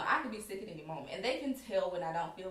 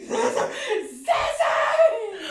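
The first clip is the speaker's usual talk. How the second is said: high, loud crying calls through laughter; calling out; 15 dB louder, much slower than usual. Retold or imitated. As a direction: call her name across the room, distressed. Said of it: "I was like".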